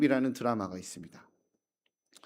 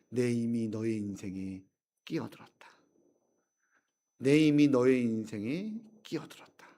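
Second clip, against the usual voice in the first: tender resolve; 3 semitones lower than usual. Retold or imitated. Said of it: "손을 내밀며 이야기하는". (왕비가 여종에게 손을 내밀며 부드럽게)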